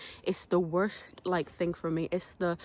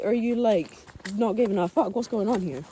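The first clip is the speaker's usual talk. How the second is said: normal talking voice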